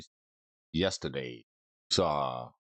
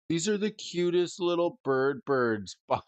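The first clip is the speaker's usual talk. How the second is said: cutesy high voice; 8 semitones higher than usual. Real, not acted. Gushing over the cute bird spider card, high cutesy voice. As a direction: coo over an adorable card.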